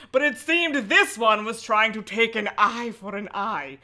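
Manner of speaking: ominous read-aloud; lightly dramatic reading; story narration. A narrator's reading. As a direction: narrate with a hint of dread. Reads the horror book aloud.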